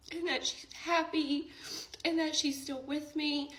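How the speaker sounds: high, cracking, close to tears; words squeezed out through tears; voice breaking. Real, not acted. Telling viewers grandma's message, voice breaking. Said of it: "try not to tear up".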